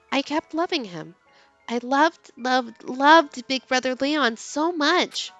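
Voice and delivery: usual speaking voice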